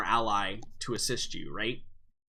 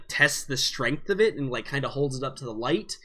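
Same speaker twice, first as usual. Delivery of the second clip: usual speaking voice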